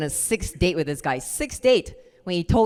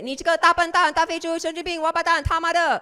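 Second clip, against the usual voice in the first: high, punchy string of insults; rapid Chinese insults; 12 semitones higher than usual. Retold or imitated. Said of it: "And I said".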